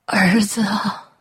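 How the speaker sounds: slow, breathless whisper; barely able to speak after fainting; just-revived mother whispers weakly to her son. Acted.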